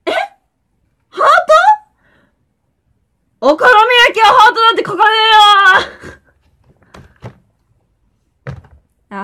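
high, loud snapping maid voice; rough maid outburst. Pretend. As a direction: break character with a yell. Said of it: "この声やだね". (怒鳴るメイド声)

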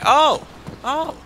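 sharp high yelps of surprise; startled high exclamations; surprised. Real, not acted. Surprised by new emotes.

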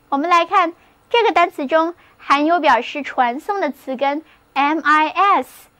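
usual speaking voice

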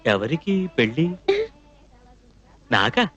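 very low, halting questions; stunned disbelief. Acted. Man hears of his own wedding, low stunned disbelief. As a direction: drop the pitch deep and leave long pauses.

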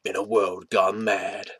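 slow, portentous trailer voiceover; drawn-out mock-epic announcer; movie voice. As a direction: intone it like a film trailer. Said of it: "my movie voice".

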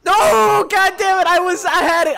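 loud, high cry of frustration; frustrated outburst. Real, not acted. Player losing a lined-up shot, frustrated yell. Goal chance lost.

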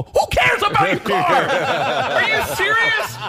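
very high, squealing disbelief; hammed-up high-pitched incredulous yell; incredulous outburst. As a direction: shriek it in mock outrage. Teller outraged at friend's concern.

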